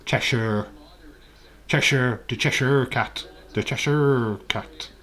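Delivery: American Cheshire with a hard final R; exaggerated American R on Cheshire; mock American accent. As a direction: imitate an American saying Cheshire. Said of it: "Watching an American saying Cheshire".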